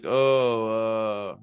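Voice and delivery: drawn-out, clueless stalling; mock hesitant Riddle. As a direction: imitate someone groping for a forgotten line. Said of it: "then Riddle's like".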